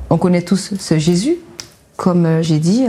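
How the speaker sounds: ordinary talk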